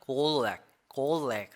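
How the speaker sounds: garlic drawn out, shaped unlike the previous spelling; contrasting pronunciation. Shown as a demonstration. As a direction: say garlic slowly as a different spelling. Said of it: "완전히 다르죠". (garlic을 다른 철자대로 천천히 발음)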